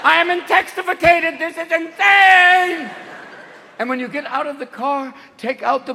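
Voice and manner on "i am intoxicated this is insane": high, slow, panicked cries; the brain melting down; overwhelmed brain. Retold or imitated. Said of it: "your brain will eventually go".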